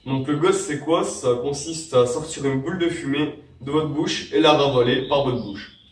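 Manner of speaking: usual speaking voice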